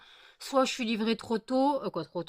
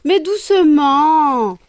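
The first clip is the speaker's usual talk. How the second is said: calling out high and loud, slow, with a laugh; laughing, drawn-out plea to calm down; 6 semitones higher, 15 dB louder, much slower than usual. Real, not acted. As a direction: shout slowly over the pets to calm them. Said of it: "calming her fighting pets".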